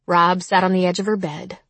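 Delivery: usual speaking voice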